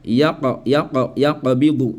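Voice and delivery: "yaqbidu" with an echoed q, ya-qa-bidu; qalqala. Shown as a demonstration. Teacher applies the rule, qalqala echo. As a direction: bounce the q in "Yaqa", then "yaqbidu". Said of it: "Si je vais appliquer la règle".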